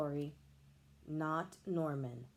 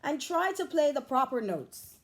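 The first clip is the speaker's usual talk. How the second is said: louder, sharp order; snapping teacher; 8 semitones higher, 6 dB louder than usual. A narrator's reading. Music teacher scolding the tuba pupil, a louder snap. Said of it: "snaps Maestro".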